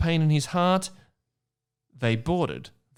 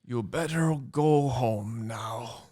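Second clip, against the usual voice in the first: slow, lightly voiced Hagrid; a hint of the lumbering half-giant; slower than usual. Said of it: "said Hagrid".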